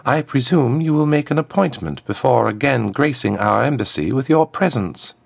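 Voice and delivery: high, smooth voice of a formal diplomat; a smooth ambassador, pitched well up; smooth contralto ambassador. A narrator's reading. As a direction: address royalty smoothly in a high-set voice. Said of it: "her smooth contralto echoing in the hall".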